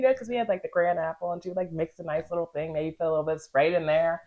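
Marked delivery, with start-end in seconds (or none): none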